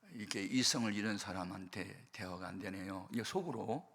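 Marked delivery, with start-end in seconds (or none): none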